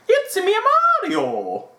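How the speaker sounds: very high cartoon Italian catchphrase, dropping on the name; Mario. Host mocking how his accent went, Mario impression. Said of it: "it sounded like Mario".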